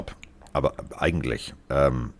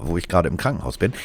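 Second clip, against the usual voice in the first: normal talking voice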